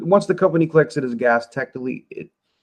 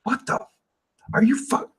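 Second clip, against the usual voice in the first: retold stunned disbelief, broken off mid-word; mock incredulous sputtering; imitated freak-out; 11 semitones higher, 4 dB quieter than usual. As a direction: act out someone's shocked first reaction in fragments. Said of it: "they're freaking out".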